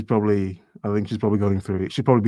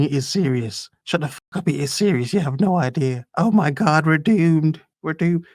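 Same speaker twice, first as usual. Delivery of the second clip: higher, frantic mock outburst; mock panic; 6 semitones higher than usual. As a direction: ham up the doomed women. Acting out panicking women.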